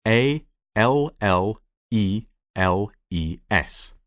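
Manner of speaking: slow, letter by letter; measured spelling for a class. Shown as a demonstration. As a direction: spell it slowly, a pause after each letter. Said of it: "how do you spell it".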